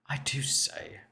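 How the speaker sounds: whispering. Pretend. Mock old-timey aside.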